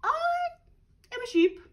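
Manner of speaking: very high, loud sugary sheep voice; sweetly. Acted. Disguised wolf claiming to be a sheep, sweetly. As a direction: lie sweetly in a high bleat. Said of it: "said the wolf sweetly".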